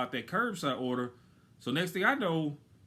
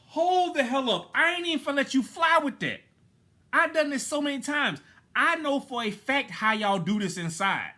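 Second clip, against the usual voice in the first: outraged pushback; 10 semitones higher, 5 dB louder than usual. Real, not acted. Customer refusing the manager's excuse, loud outraged. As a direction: shut the excuse down hard.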